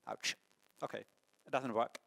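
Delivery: normal talking voice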